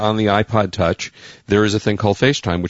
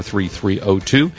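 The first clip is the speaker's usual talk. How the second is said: normal talking voice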